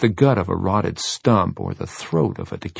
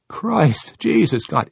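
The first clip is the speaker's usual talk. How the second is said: panicked cry; 6 semitones higher, 4 dB louder, slower than usual. Acted. Boy panicking over a corpse.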